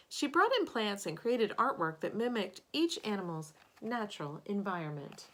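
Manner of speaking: expressive picture-book narration; storybook read-aloud voice; reading to children. Acted. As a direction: read it aloud to a class of kids. Reads picture book to class.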